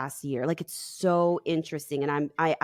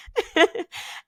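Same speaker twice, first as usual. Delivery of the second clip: laugh; 14 semitones higher, 3 dB louder than usual. Real, not acted. Laughs after story.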